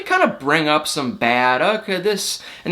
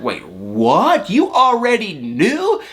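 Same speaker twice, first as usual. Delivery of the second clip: drawn-out question climbing steeply high; hammed-up astonished question; mock disbelief; 3 semitones higher, much slower than usual. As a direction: imitate her stunned reaction, slowing and rising. Acts out her disbelieving reaction.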